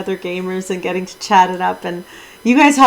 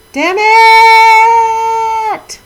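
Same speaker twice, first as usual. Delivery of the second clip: much higher, loud frustrated shout; angry outburst; 13 semitones higher, 10 dB louder than usual. Real, not acted. Streamer failing to dock her ship, shouting in frustration. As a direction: blurt it out in real anger. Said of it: "I'm getting angry".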